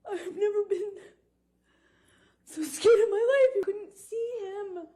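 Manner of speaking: high, loud tearful voice; crying in real distress. Real, not acted. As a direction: break down crying as you relive the fear. Tearful testimony, breaking down in fear.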